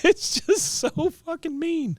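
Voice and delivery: high, slow squeal through laughter; helpless amusement. Real, not acted. Host cracking up at the veteran's cruelty, laughing real squeal. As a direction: squeeze the words out while cracking up.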